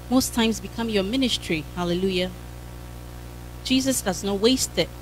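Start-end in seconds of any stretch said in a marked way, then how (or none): none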